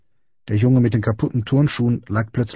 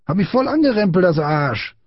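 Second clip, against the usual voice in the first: put-on high outraged boy's whine; indignant complaint; 9 semitones higher than usual. A narrator's reading. Small boy accusing Friedrich to big boy, high outraged voice.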